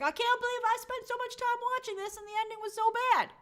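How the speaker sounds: high, put-on voice of disbelief; hammed-up indignant rant; mock outrage. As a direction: whine about wasted hours in a silly voice. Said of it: "I was like".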